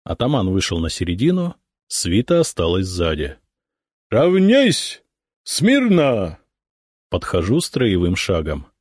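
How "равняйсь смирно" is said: shouting drill sergeant. A narrator's reading. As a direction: bellow the drill orders across the yard. Ataman commands the formation.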